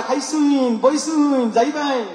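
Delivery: lightly mimicked Mymensingh dialect; a playful hint of Mymensingh speech. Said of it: "এরপর ময়মনসিংহের দিকে যাবেন".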